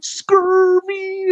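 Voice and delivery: high startled squeak; mock scared yelp. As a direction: yelp it like a spooked kid. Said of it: "I hear Frank go".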